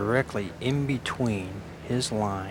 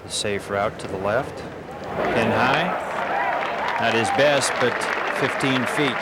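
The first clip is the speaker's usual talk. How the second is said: louder live golf play-by-play; golf commentator; 4 dB louder than usual. Real, not acted. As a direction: call the shots like a broadcaster.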